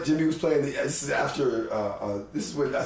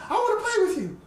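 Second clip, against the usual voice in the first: put-on high child voice, pitched way up; little boy. Small boy answering the great musician, high child voice. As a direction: imitate a small boy asking to join in. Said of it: "He says".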